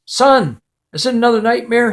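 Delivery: high, quick, lightly acted worry; urgent fatherly question, voice lifted; concerned dad. Dad checks on terrified son.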